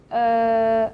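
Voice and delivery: "Uh" with lips spread wide; unrounded uh. Shown as a demonstration. Teacher modelling the Mandarin vowel for learners, lips spread. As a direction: hold a clear, spread-lip "Uh". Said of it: "spread your lips like smiling".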